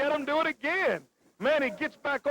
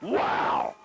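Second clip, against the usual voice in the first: amazed shout. Real, not acted. Big move in the ring.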